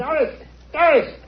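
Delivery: high call from another room. Acted. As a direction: call for her from afar. Old uncle calls from room.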